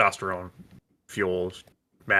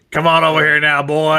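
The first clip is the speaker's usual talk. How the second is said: mock uncle calling, louder; folksy uncle call; 4 semitones higher, 7 dB louder than usual. Cohost voices Uncle Kratos calling to the boy. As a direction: call him over like a hammy country uncle. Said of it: "Uncle Kratos".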